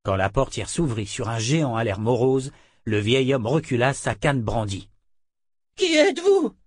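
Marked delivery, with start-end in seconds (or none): 5.8-6.6 very high, loud cry; shouted challenge. A narrator's reading. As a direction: shout the question at a stranger. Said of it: "cria-t-il". (cri aigu et fort)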